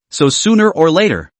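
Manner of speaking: plain reading voice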